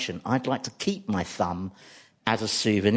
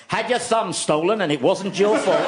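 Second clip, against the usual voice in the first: advert voiceover; 7 semitones higher than usual. Retold or imitated. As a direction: sell it like a claims-lawyer TV ad. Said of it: "men saying".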